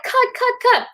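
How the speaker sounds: high-pitched mock call to halt; Russell Crowe. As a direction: imitate an exasperated star stopping the take. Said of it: "And then he's like".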